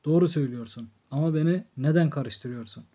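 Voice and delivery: ordinary delivery, nothing marked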